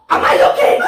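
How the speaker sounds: hammed-up angry yell, breaking into laughter; mock angry. Friend exaggerating the girl's retort to him, mock angry yell. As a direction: overdo the scolding, loud and amused. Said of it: "she doesn't say that angry".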